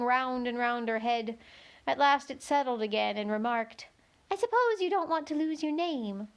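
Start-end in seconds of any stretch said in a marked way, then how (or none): 4.3-6.3 high, thin little insect voice; a tiny gnat piping up to Alice. A narrator's reading. Gnat telling Alice where to look, high and thin.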